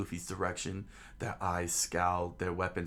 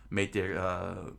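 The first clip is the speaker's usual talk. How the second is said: plain reading voice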